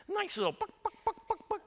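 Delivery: very high squeaky chicken clucks; clucking chicken. Pretend. Preacher mimics the chicken farm with squeaky clucking. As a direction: cluck like a hen in the barnyard. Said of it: "strongest odor there is".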